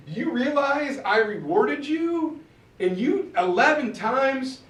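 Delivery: exasperated scolding. Acted. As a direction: play a captain telling off his hunter. Captain scolds the failed hunter.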